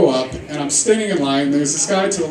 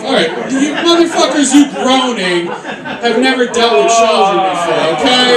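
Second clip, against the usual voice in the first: raised, high-pitched telling-off; mock scolding; 5 semitones higher than usual. Real, not acted. Comedian to the groaning crowd, raised mock scolding. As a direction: push back at the groaners with higher pitch.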